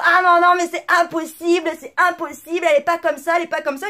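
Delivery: voix plus haute et plus forte qui proteste en chœur; protestation imitée. Retold or imitated. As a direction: jouer l'indignation de l'équipe qui défend sa collègue. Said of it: "Et là, tout le monde". (l'équipe proteste, imitée avec une voix plus haute et plus forte)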